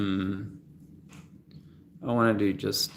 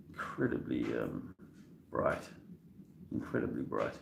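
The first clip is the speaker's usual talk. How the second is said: real emotion welling, much lower and softer; choked up; 8 semitones lower, 9 dB quieter than usual. Real, not acted. Talking to viewers about his son, choked up. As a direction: voice dropping as feelings catch him. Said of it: "I get a little bit emotional".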